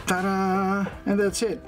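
ordinary talk

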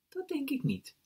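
very high, soft lady voice; mevrouw Citroen. A narrator's reading. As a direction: answer high and soft, knowingly. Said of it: "zei mevrouw Citroen".

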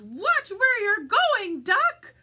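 high, loud, slow hen outcry; chicken's alarmed warning. Acted. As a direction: cry out a warning as a startled chicken. Chicken's alarmed thought in story.

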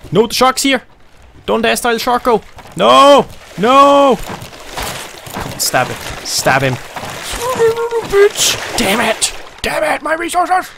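high, loud angry shouts; panicked yelling. Real, not acted. Gamer losing resources to the shark, panicked yelling. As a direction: scream as the shark wrecks your stuff.

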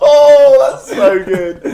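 laughing high and loud through the words; bursting out laughing. Real, not acted. Host cracks up at the album title, laughing loudly. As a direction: crack up at the joke, words squeezed out between laughs.

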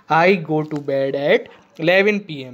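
very slow, word by word; slow dictation. Shown as a demonstration. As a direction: dictate so children can write it. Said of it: "teacher dictating an example sentence". (धीरे-धीरे वाक्य लिखवाना)

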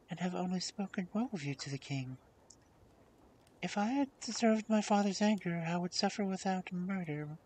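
high, gently pleading princess; gentle princess. A narrator's reading. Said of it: "she said gently".

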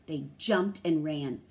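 ordinary delivery

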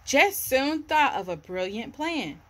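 ordinary delivery

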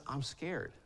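put-on very soft, small frightened child; scared toddler whisper. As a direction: hush it like a scared two-year-old.

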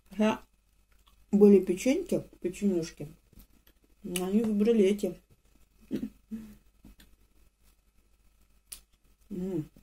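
chewing while talking; speaking between crunchy bites; mouth full. Real, not acted. Seen 2: Bites the brown rusk at 0.2–1.1, then talks while chewing.

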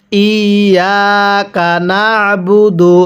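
slow, level, drawn-out ইয়াকা না'বুদু; model recitation. Shown as a demonstration. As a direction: hold ইয়াকা long, press the ayn in না'বুদু. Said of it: "আমার সাথে মিলিয়ে পড়ুন".

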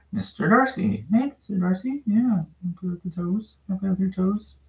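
high cooing pet voice; puppy talk. Real, not acted. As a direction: coo up high at the pup.

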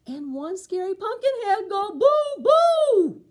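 building to a loud, high boo; story climax boo for the kids; spooky build to boo. Acted. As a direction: rise up and shout the boo. The whole gang scares her.

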